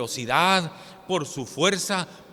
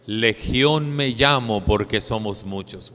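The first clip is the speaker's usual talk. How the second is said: demon's answer; 5 semitones lower, 5 dB louder than usual. Acted. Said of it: "La respuesta fue".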